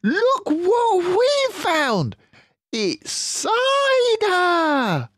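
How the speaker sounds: very high, slow and loud cub voice; thrilled small fox. Acted. Smallest fox shows his dad the cider, thrilled. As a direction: stretch it out in delight.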